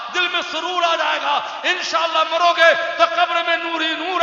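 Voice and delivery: high, loud, shouted sermon at full force; fiery preaching. Acted. Preacher shouting to the gathering to pray, at full force. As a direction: roar the call to prayer over the crowd.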